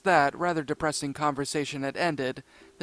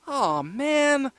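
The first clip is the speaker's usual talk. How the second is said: put-on disappointed girl voice; 9 semitones higher than usual. A narrator's reading. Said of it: "Uniqua said".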